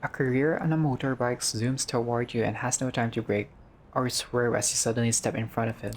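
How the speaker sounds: ordinary delivery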